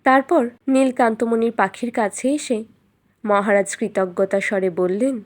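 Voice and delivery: usual speaking voice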